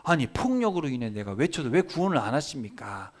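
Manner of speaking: indignant protest, leaping high at the start; acted exasperated complaint; arguing with God. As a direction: plead your case accusingly to God. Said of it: "하나님한테 따지는 거예요".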